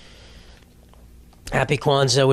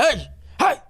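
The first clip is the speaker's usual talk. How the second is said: mock parent yell; 10 semitones higher than usual. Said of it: "It's gonna be so fun just to be like".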